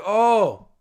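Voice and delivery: a sharp, high, loud oh; real shock at the play; surprised oh. Real, not acted. Reacting live to a turnover.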